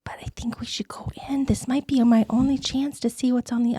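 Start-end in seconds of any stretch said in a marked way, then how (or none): 0.0-1.5 hushed whisper. Acted. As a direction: whisper it so no one hears. Sneaking near portal, hushed talk.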